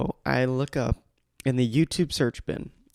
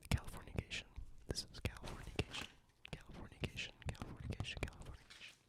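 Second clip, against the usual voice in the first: repeated unvoiced whisper of one word; ASMR whisper; 22 dB quieter, faster than usual. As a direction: mimic ASMR repetition, breathy and close. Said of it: "they say things three times".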